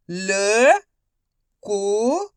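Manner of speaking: ordinary talk, nothing marked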